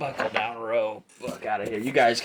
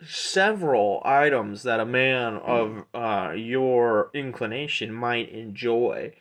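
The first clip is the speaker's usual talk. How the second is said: smooth-talking merchant Aldor; 4 dB louder, slower than usual. Acted. Aldor offers weapons to a customer, smooth-talking merchant. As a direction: draw it out like a salesman. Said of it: "he goes".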